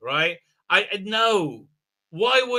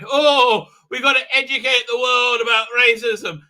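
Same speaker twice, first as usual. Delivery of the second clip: loud, higher mocking voice; mock earnest; 6 semitones higher, 9 dB louder than usual. Mocking writers who want to educate the world, mock earnest. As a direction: imitate a do-gooder proclaiming a cause, overblown.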